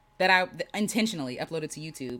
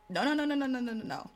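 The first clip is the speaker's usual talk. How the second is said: rapid-fire refusal; 4 semitones higher, 3 dB quieter than usual. Acted. Mock refusal of being thought perfect.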